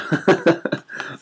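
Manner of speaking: light breathy chuckle. Real, not acted. Amused at own pronunciation lesson, a soft chuckle. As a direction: laugh briefly at yourself.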